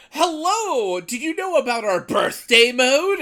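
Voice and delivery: high, loud, slow sales pitch voice; cheery customer service voice. Retold or imitated. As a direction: chirp it like a bubbly app rep. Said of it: "It was just like".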